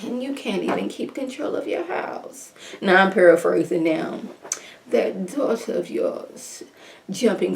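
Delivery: hushed, disdainful upper-class mama; bougie mom voice. Retold or imitated. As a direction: scold her grown daughter like old money. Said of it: "this bougie voice that the mom was giving".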